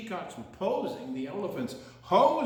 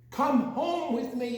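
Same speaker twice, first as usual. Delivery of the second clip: higher home; 7 semitones higher, 4 dB louder than usual. Shown as a demonstration. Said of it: "It changed on the end".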